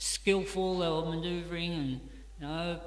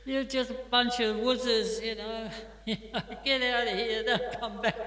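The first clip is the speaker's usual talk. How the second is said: mock bravado shouting; 6 semitones higher than usual. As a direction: crow at the fleeing bullies, half laughing. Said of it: "us guys find our bravado".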